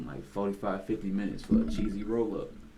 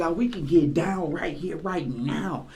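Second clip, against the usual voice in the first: retold challenge; 7 semitones higher than usual. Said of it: "He was like".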